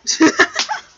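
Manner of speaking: high, loud laugh; high burst of laughter. Real, not acted. Laughing as the cat begs to leave.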